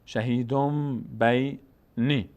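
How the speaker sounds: شهیدم بینی very slowly, long m before b; n turned to m. Shown as a demonstration. Teacher models the full reading, شهیدم بینی slow with held m.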